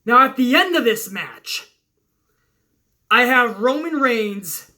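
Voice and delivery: usual speaking voice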